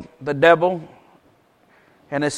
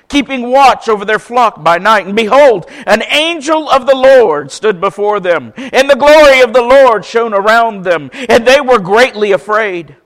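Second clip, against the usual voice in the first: raised, louder preacher reading voice; scripture reading; 6 semitones higher, 6 dB louder than usual. Acted. Pastor reading Luke aloud to the church, raised proclaiming voice. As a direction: proclaim the passage to the congregation.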